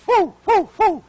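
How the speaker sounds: high, loud whoosh of friends fleeing; playful whoo whoo of running away. Retold or imitated. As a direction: imitate the friends zipping off.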